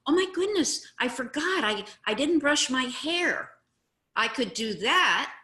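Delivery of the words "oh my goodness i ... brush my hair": high, loud mock realization; exaggerated "oh my goodness" admission; acted agreement. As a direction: cheerfully own up, hammed-up. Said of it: "What I could say is".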